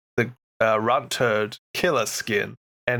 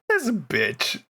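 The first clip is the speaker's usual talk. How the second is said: exasperated; 7 semitones higher than usual. Retold or imitated. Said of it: "we're just like".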